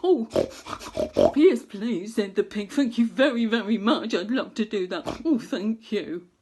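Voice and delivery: loud, happy piglet voice; lightly voiced eager pig; grateful pig. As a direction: thank him as the excited pig. Pig character speaks.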